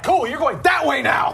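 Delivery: mock ride operator. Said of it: "They're like".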